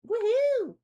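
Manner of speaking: high, loud woohoo cheer; excited. Real, not acted. Echoing a cheer.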